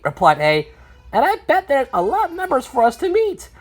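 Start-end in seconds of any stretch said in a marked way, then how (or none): 1.1-3.5 high character voice; reply keenly in a high cartoon voice; eager letter character. A replies to the numbers.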